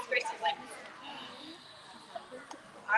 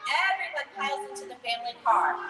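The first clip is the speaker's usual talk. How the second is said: ordinary talk, nothing marked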